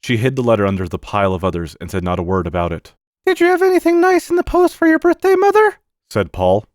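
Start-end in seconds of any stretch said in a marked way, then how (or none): 3.2-5.8 put-on very high, loud child voice; eager little boy. Boy asking mother about her birthday post, high and eager. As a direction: ask brightly as a small boy.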